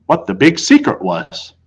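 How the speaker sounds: ordinary delivery, nothing marked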